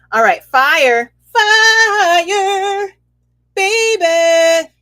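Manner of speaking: loud, high, drawn-out hype; a hammed-up hype call; hyped sing-song. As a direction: belt it like a hype chant. Hyping up fire energy.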